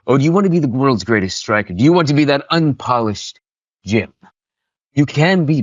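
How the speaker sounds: theatrical coach tempting a rookie striker; villain monologue. Acted. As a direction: goad them with grand bad-guy relish. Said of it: "they want me to break them down".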